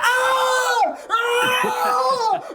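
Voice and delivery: loud, very high mock death screams; imitated screaming. Podcaster acting out Quint's shark death, screaming. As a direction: scream out as if being eaten, hammed up. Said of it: "that's when you get the screaming".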